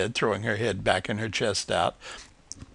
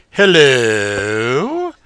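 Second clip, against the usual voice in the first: deepest voice; 10 dB louder than usual. A narrator's reading. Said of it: "she said in her deepest voice".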